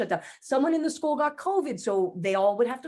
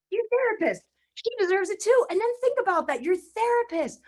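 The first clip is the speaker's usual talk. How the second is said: higher, lightly voiced gentle self-scolding; inner wiser voice; 7 semitones higher than usual. Speaker lecturing herself about her therapist, higher gentle voice. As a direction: talk yourself round like a kind friend.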